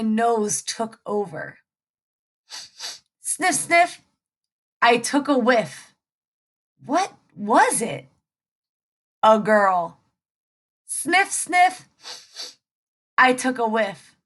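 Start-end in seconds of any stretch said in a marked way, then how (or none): 3.2-4.0 high sniff sniff. Acted. Hungry wolf sniffing out the girl, high sniffs. 10.9-11.9 high sniff sniff. Acted. Hungry wolf sniffing out the girl, high sniffs.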